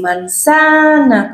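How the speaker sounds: "Manzana" slow and high, stress on the middle "za". Shown as a demonstration. Therapist modelling the word for a child, slow. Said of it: "porque es una palabra grave".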